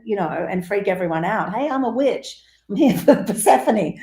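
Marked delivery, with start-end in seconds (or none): 1.5-4.0 mock brash witch. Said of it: "you don't just go".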